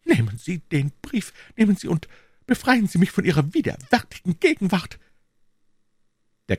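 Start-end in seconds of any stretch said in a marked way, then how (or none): 0.0-5.0 tragic heroine sorrow; high, trembling voice of despair. A narrator's reading. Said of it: "mit bebender Stimme sprach".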